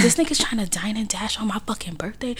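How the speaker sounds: incredulous whisper. Retold or imitated. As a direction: hiss it under your breath. Retold whispered aside to herself.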